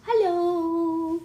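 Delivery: high, loud hello with a long stretched O; imitating Aunt Renee. Mom copying how Aunt Renee greeted the baby, stretched-out hello. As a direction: sing out "Hello." with lots of O's. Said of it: "Like that, with a lot of O's".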